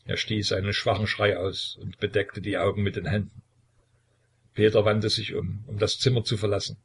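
ordinary delivery

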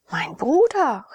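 a touch of shocked surprise; astonished. A narrator's reading. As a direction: exclaim in astonishment at a brother. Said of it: "rief sie erstaunt".

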